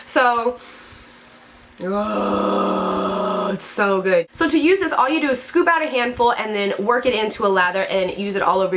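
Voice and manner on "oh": ordinary talk, nothing marked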